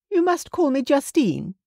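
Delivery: a mother recovering her poise; Justine's high voice. A narrator's reading. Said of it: "she said after a brief pause".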